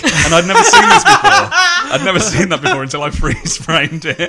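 words broken by laughter; can barely get it out for laughing; laughing while talking. Real, not acted. Recounting a joke, cracking up.

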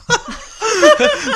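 high burst of laughter; loud laugh. Real, not acted. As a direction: let out a big laugh. Big laugh at the score.

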